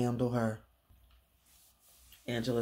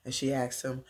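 ordinary talk

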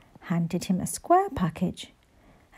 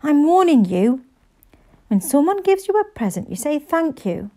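warning; 6 semitones higher, 4 dB louder than usual. A narrator's reading. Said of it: "hissed Mum".

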